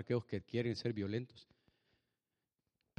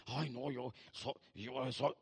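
mock sulky; 2 semitones lower, 7 dB quieter, much slower than usual. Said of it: "no tome la actitud de enojo".

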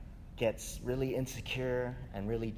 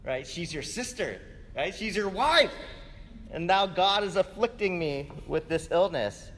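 higher, quick, indignant king's voice; Pharaoh confronting Abram; 6 semitones higher, 4 dB louder than usual. Retold or imitated. Pharaoh scolding Abram for lying, indignant and higher. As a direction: play the wronged Pharaoh scolding. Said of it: "he's like".

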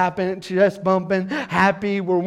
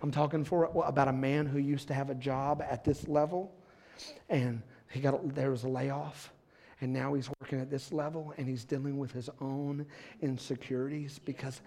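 normal talking voice

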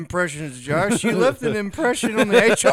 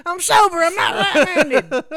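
laughing confession; 9 semitones higher, 7 dB louder than usual. Real, not acted. Loopy sober joke.

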